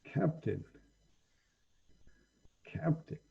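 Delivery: hushed dragon creature, nearly whispering; quiet echo. Acted. Dragon mulling over the visitor's request for the captain, hushed. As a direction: repeat it softly, fading into a whisper.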